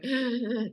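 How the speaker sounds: high mock-panic gasp; panicked gasp. Brain not hearing validation from him, mock panicked gasp. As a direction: gasp as if suddenly freaking out. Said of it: "your brain starts freaking out going".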